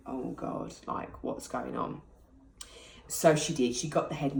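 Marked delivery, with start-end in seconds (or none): 0.1-2.0 hushed, half-whispered, slow dread; frightened inner thought. Retold or imitated. Mother waiting for the midwife, whispered dread. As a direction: say it under your breath as panic creeps in.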